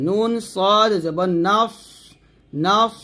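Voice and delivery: "নাফ" rounded, ending in a long thick s; heavy Sad. Shown as a demonstration. Teacher modelling for learners, heavy hissed ending on "নাফ". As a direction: spell slowly, "নাফ" closing on emphatic s. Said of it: "সদ মোটা উচ্চারণ করতে হবে".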